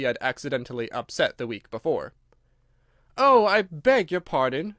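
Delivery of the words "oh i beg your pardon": high, loud, drawn-out apology; dismayed outcry, stretched slow; great dismay. A narrator's reading. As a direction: exclaim in utter dismay. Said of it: "in a tone of great dismay".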